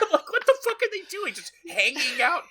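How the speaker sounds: high, loud, fast baffled yelp; baffled outcry. Retold or imitated. Viewer yelling at the screen in disbelief, high and loud. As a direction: squeal it out in disbelief, quick. Own baffled reaction to movie.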